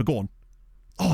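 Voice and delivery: ordinary delivery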